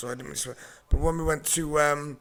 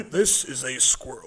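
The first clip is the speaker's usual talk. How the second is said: slow, measured nature narrator impression; nature programme narrator; 2 semitones lower, slower than usual. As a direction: narrate it slowly like a wildlife documentary. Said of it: "a narrator in nature programs".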